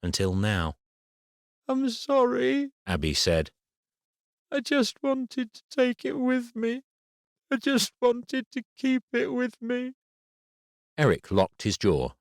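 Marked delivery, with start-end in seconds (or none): 1.7-2.7 put-on much higher, slow child's voice near tears; a hurt girl accusing the boy; tearful little girl. A narrator's reading. Crying daughter apologises. 4.5-9.9 put-on a hurt girl accusing the boy; tearful little girl. A narrator's reading. Crying daughter explains.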